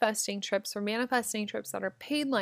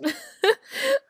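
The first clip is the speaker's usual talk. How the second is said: sudden high giggle; high burst of laughter; 5 dB louder than usual. Real, not acted. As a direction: break into a squealing laugh. Laughs at own struggle.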